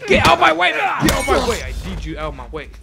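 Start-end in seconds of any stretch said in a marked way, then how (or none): 0.1-1.6 high, loud yell; frantic shout. Real, not acted. Player yelling at enemies blocking the way, frantic shout. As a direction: shout at them to clear off.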